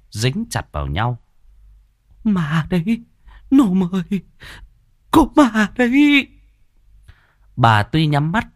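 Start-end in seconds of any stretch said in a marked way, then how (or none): 2.2-6.3 high-pitched wail of fright; frightened woman, rising louder and higher; terrified cry. A narrator's reading. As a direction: wail in terror to your son. Terrified woman cries out about ghosts.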